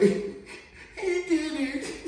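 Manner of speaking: put-on slow, high, laughing kid pointing the finger; little sucker kid. Little kid telling on the bully, slow and laughing. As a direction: drag it out, giggling, high. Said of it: "like a little sucker that I was".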